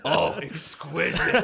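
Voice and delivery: slow, posh-ish appreciation; mock connoisseur. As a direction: savor the word slowly. Mocking admiration of clown.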